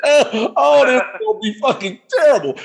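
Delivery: laughing high "oh" then dread; mock dread with a laugh; nervous laugh dread. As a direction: giggle through the fear of what's coming. Dreading the hot wing.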